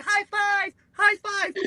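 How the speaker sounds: high, squeezed little voice; mock eager celebration. Fat Marine celebrating with his buddies, goofy high-pitched eagerness.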